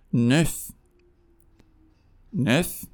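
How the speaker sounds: نف with a rounded eu vowel; نف as French neuf. Shown as a demonstration.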